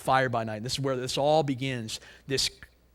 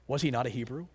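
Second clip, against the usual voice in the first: ordinary delivery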